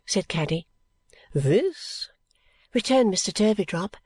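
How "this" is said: very slow, grandly drawn-out address; self-important patriarch; pompous old father. A narrator's reading. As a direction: bestow each word like a blessing.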